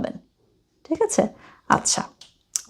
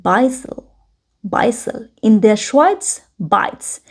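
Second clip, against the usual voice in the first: very slow, careful textbook read; slow German reading; 3 semitones higher, much slower than usual. Shown as a demonstration. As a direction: read each word out slowly for learners. Reads German text slowly. (ধীরে ধীরে German পড়া)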